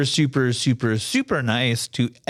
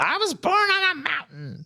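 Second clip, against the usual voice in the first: imitating mom. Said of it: "she's like".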